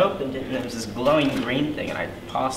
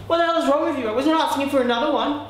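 indignant; 11 semitones higher, faster than usual. Acted. Angry after being hit.

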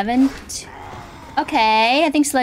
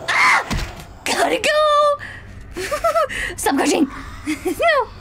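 much higher, laughing screams while fleeing; laughing panic cry; panicked laughing shriek; slower than usual. Real, not acted. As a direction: squeal and laugh, running for your life.

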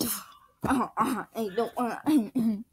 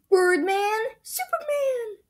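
high squeaky voice; 12 semitones higher, 3 dB louder, slower than usual. Acted. High cartoon voice.

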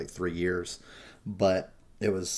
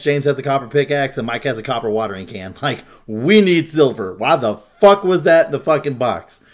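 ordinary talk, nothing marked

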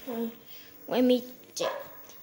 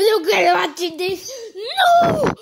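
high shriek of mock despair; laugh-crying wail; 4 semitones higher, 4 dB louder than usual. Character upset, laugh-crying.

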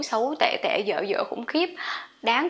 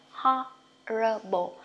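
Horrible drawn out slowly, syllable by syllable; slow teaching take of Horrible; slow model; much slower than usual. Shown as a demonstration. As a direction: stretch Horrible for learners. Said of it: "Đọc o dài cũng được".

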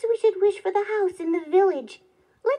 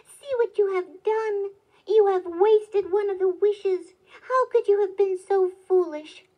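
loud, raised, exasperated reproach; scolding cry; 6 semitones higher, 6 dB louder than usual. A narrator's reading. Wife scolds husband for wasting a wish, loud and cross. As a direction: berate him at a higher pitch, hammering key words. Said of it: "cried the woodcutter's wife".

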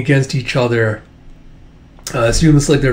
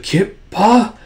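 high, halting, rising question; dazed recognition; 7 semitones higher than usual. Acted. Micah recognizing Kippa, high hesitant question. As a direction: say the name as if just waking.

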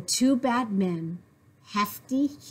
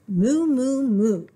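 loud moo; 3 semitones higher, 6 dB louder, slower than usual. Acted. Duck moos loudly.

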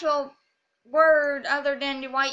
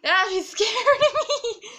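loud, high, laughing through the words; laughing after fright; 8 semitones higher, 7 dB louder than usual. Real, not acted. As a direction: let nervous laughter break up the line. Repeats it laughing after scare.

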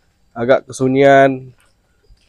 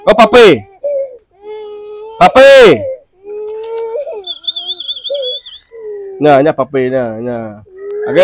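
higher, louder sing-song coaxing voice; coaxing a toddler; 6 semitones higher, 4 dB louder than usual. Acted. Parent coaxing a crying child to repeat words, high sing-song coaxing. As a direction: coax the little one along, voice lifted.

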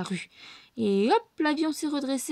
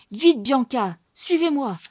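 urgent; 3 semitones higher, 7 dB louder, slower than usual. A narrator's reading. Bernard urgent.

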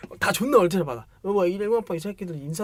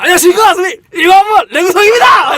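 a military-style greeting yelled at the top of the voice, much higher; shouted greeting; 12 semitones higher, 16 dB louder than usual. Retold or imitated. Freshman made to greet the seniors as a model, shouted greeting. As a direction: bellow it like a drilled freshman. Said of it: "인사 거기서 막 하고".

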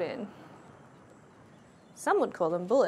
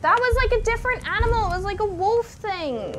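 high, loud, fast outburst; alarmed; 9 semitones higher, 6 dB louder than usual. Real, not acted. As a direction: blurt it out in rising alarm. Alarmed after the attack.

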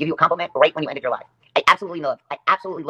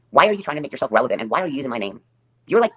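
ordinary talk, nothing marked

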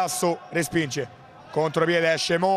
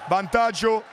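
breathless italian goal commentary; 3 semitones higher than usual. Real, not acted. Own goal, commentator erupts. (telecronaca concitata dell'autogol)

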